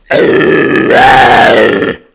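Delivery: very loud sustained harsh scream; extreme scream. Shown as a demonstration. Vocalist showing off scary sounds to chat, full scream. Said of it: "I can go".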